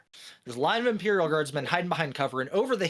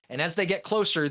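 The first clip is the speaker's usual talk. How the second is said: normal talking voice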